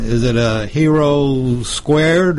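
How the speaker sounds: ordinary talk, nothing marked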